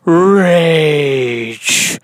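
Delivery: high, loud theatrical sympathy; hammed-up host overdramatizing, slow and swooping; Sid Spielman. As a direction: wail it grandly, stretching each word. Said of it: "I'm your host, Sid Spielman".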